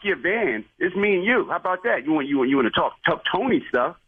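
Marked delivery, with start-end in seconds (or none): none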